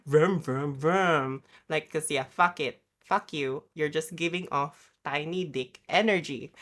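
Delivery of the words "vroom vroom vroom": vroom vroom engine noise, louder; mock revving engine. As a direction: imitate a car revving up. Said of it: "so I could be like".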